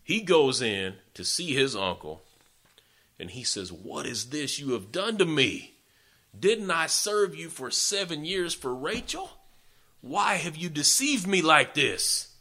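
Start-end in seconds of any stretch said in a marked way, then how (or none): 3.7-12.3 raised, higher pitched protest, slowing at the end; a wronged man's hammed-up indignation; indignant outrage. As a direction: demand answers from the uncle who tricked you. Jacob confronts uncle over deception.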